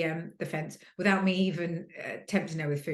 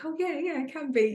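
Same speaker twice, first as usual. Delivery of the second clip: Mum's agreement, pitched higher; a breezy, higher imitation of Mum's "yeah, yeah"; imitating Mum; 10 semitones higher than usual. Sister quoting Mum's agreement, mock high and breezy.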